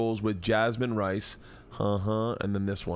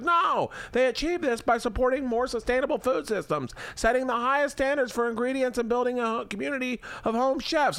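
much higher, sing-song wife voice; wife Leanne voice; 12 semitones higher than usual. Acted. Husband voices wife Leanne pitching Blue Apron, high sing-song. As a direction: play the chirpy wife selling the meal kit. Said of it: "Do you like this character thing I'm doing?".

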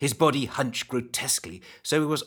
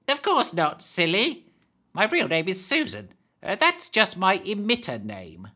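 put-on higher, creaky old hag; 8 semitones higher than usual. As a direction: play the hag, urgent warning. The hag, manic.

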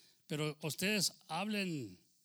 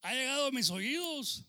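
loud, raised quoting voice; declamación de Pablo; 9 semitones higher, 5 dB louder than usual. Acted. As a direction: proclaim it high and strong to the congregation. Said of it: "decía Pablo". (declamado fuerte y agudo)

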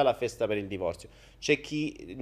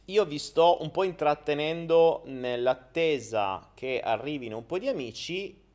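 ordinary talk, nothing marked

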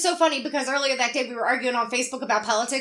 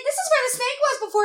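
put-on chirpy kid; 10 semitones higher than usual. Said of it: "she's like".

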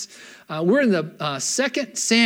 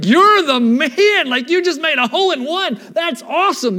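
mock praise; 8 semitones higher, 6 dB louder than usual. Buddy praising a hole in one, cheering. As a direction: hype him up like a golf pal. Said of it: "And that's not like".

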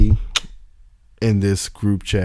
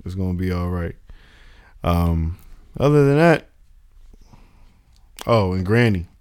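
ordinary talk

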